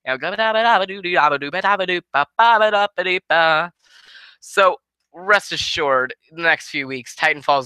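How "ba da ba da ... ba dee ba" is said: high nonsense babble; insane rant babble. Retold or imitated. Vlogger imitating his own insane rant, high scat babble. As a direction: babble like a madman ranting. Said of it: "my insane type of rant".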